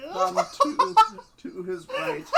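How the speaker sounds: loud high laughing words. Real, not acted. Reader laughs while reading.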